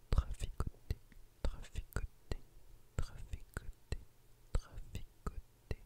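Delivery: whispered word repeated syllable by syllable; whispered trigger word. Acted. ASMR creator playing with a trigger word, whispered repetition.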